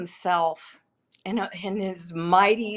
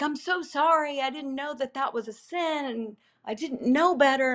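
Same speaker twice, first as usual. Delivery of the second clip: higher, pleading apology to God, half-crying; tearful repenting; 6 semitones higher than usual. Retold or imitated. Retelling her prayer to God, tearful repenting. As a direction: plead for forgiveness like a child. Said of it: "like, repenting".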